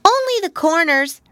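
high, small voice; a sweet little confession; little pig voice. Acted. Pigwig answers Pigling.

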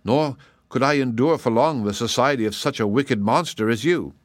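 ordinary delivery

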